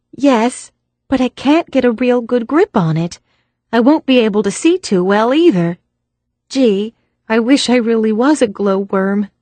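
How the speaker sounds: a hint of a young girl's voice; young woman character. A narrator's reading. Glowworm speaking to Philip.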